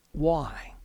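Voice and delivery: ordinary talk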